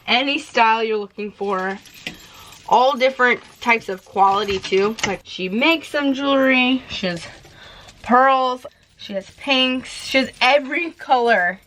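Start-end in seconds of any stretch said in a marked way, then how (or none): none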